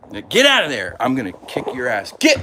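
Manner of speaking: loud, sharp scolding of a misbehaving cat; scolding shout. Real, not acted. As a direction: yell at the cat to get out. Scolding cat away from litter.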